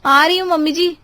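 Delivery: dutiful shout back downstairs; calling back. Acted. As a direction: call down that you're on the way. Answers a call from downstairs.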